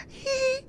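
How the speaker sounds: high squeaky giggle; mock giggle. Imitating the giggling Chinese girls at the coworker. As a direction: squeal like a shy fan.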